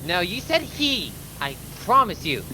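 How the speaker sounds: ordinary talk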